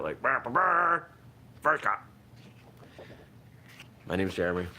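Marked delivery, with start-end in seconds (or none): 0.2-2.1 high, loud fanfare noises; mock opening credits fanfare. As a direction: belt it like a theme tune intro. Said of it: "I want, like, a real opening credit".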